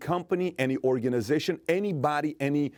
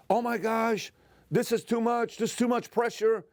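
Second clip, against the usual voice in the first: much higher, mocking a complainer; mock overwhelmed whiner; 10 semitones higher than usual. Speaker mocking people who give up, high whiny mock voice. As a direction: whine it like someone buckling under pressure. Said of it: "are you the one that says".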